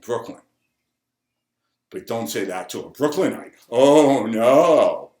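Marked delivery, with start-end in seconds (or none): 3.7-5.1 loud, drawn-out mock dismay; big hammed-up warning; mock alarm. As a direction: draw it out loudly, as if disaster struck. Mock warning about touchy Brooklynites.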